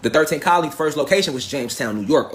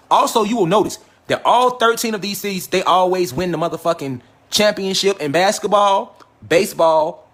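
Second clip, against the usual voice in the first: ordinary delivery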